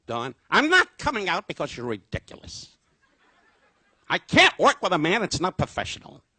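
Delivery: put-on high, loud whiny kid voice; hammed-up child throwing a tantrum; sulking little boy. Don sulking in his room like a boy, whiny. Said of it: "like a kid and close the door".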